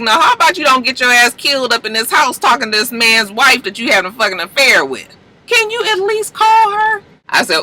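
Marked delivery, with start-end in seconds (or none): 0.0-5.2 higher-pitched, sassy comeback; mock-indignant scolding; indignant retort. Retorts to a show character. 5.5-7.0 very high-pitched, incredulous squeal; hammed-up exasperated question; exasperated plea. Scolds a show character.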